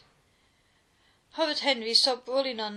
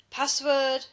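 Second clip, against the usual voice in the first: loud, higher hiss; Henry hissing the password from inside his den; 4 semitones higher, 7 dB louder than usual. A narrator's reading. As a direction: hiss it like a sentry demanding. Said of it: "hissed Horrid Henry".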